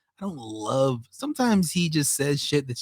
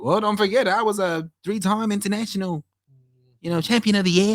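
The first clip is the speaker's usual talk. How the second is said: mocking Australian accent, pitched higher; imitating a boastful fighter with an Australian accent; Bisping in an Australian accent; 7 semitones higher than usual. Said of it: "I'm doing an Australian voice for a British guy".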